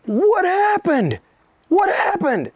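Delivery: high, very slow, drawn-out alarm; stunned bodyguards asking; mock bewildered. Bodyguards finding him on ground.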